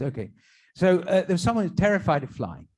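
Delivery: ordinary talk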